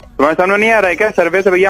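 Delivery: ordinary talk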